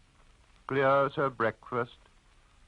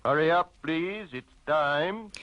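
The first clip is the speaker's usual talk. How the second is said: barman's call; 6 semitones higher than usual. Acted. Barman calling closing time.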